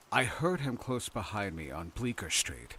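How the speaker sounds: plain reading voice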